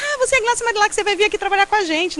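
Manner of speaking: high, gushing retold greeting; excited recognition. Cabugi staffer welcoming the supposed new reporter, excited. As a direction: imitate someone delighted to find the person.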